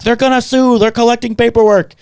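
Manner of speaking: high, loud alarmed cry; mock panic. Staff calling downstairs about a lawsuit, mock panic.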